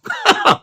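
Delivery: short high dismissive laugh, soft; brief mocking chuckle at the idea; scornful laugh. Real, not acted. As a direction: laugh him off. Scoffing laugh after argument.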